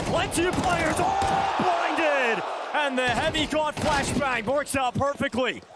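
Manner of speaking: sudden high rising shout; excited call. Real, not acted. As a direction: burst out as the flash lands. Caster reacts to big flash.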